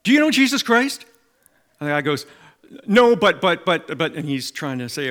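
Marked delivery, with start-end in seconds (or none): 0.0-1.0 bright, loud, much higher eager asking; exuberant question. Retold or imitated. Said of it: "John very exuberantly goes up to him". 2.9-4.2 higher, louder stuttering buts; stumbling flustered reply; flustered stammer. Retold or imitated. Stammering man on the street.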